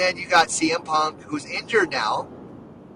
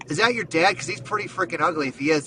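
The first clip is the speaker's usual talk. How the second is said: usual speaking voice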